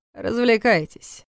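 a sinister one-word order; laconic villain command. A narrator's reading. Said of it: "лаконично велел он".

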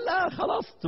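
the fan eagerly interrupts the sheikh, pitched high; sudden exclamation. Retold or imitated. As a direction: jump in to correct him. Fan cuts in excitedly.

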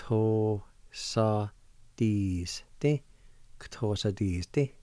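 slow, careful run through "Kto sa dis te"; slow demo. Shown as a demonstration. Teacher to listeners, slow careful pronunciation. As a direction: say "Kto sa dis te" slowly, joining the G and O into a K sound. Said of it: "connect the G and the O like this".

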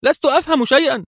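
high, fast, stunned confusion; stunned young woman lost; dazed murmur. A narrator's reading. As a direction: blurt it out bewildered. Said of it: "غمغمت منى في ذهول".

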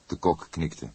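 ordinary delivery, nothing marked

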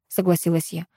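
plain reading voice

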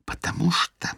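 hushed conspiratorial whisper; mock-dramatic whisper; tragic whisper. A narrator's reading. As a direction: whisper it like a grave secret. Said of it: "трагическим шёпотом".

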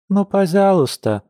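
put-on high, whining little girl's plea; whiny pleading child. A narrator's reading. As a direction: beg like a sulky toddler. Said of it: "законючила малышка".

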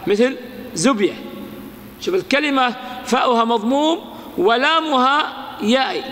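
ordinary delivery

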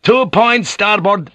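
high, slow shouted helm command; called-out order. A narrator's reading. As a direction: call it out to the helmsman. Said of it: "Rodriguez ordered".

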